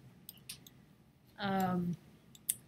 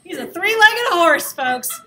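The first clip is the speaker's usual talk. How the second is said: laughing; 6 semitones higher, 13 dB louder, slower than usual. Real, not acted. Laughing at his drawn horse.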